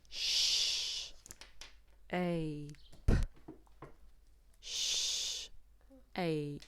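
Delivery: sh- hissed, then ape; sounding out. Shown as a demonstration. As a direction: split shape into sh- and ape. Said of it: "So we've got".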